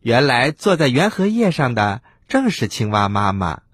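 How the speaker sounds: usual speaking voice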